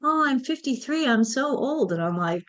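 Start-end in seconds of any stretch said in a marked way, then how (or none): none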